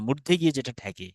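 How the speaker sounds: usual speaking voice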